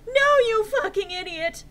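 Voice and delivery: high loud furious shout; overacted screaming insult; hammy stage actor. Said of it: "I shouted".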